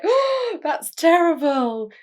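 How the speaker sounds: high, loud hammed-up gasp of shock; squealed mock outrage. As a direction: ham up the bystanders' horror. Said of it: "everyone around her is like".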